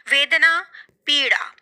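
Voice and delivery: usual speaking voice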